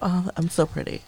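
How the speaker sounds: mock vanity. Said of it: "You're like".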